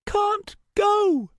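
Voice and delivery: high, firm refusal; high girl voice. Acted. Starbreeze refuses to move.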